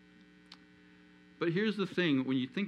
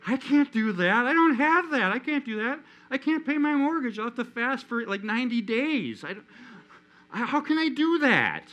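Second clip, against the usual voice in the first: panicky, much higher and louder; hammed-up panic about the money; mock terror; 9 semitones higher, 6 dB louder than usual. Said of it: "it's sheer terror".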